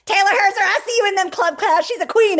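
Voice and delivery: high, loud hype for a teammate; shouting out Taylor Herzer to the whole team, high and loud; excited shout-out. Real, not acted. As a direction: cheer her on across the call.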